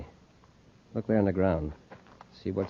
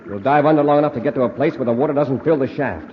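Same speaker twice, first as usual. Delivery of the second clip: urgent call; 7 semitones higher, 6 dB louder than usual. Acted. Preparing to dive underwater.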